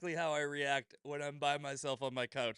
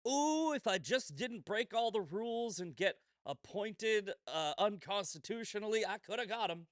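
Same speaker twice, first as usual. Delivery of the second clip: higher whining mockery of a prosecutor; mocking whiny voice; 6 semitones higher than usual. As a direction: sneer as a self-pitying loser. Mocking Jack Smith quote.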